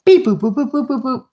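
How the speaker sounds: high playful beep boop sounds; beeping noises. Retold or imitated. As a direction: chirp out dial tones. Imitating a phone dialing.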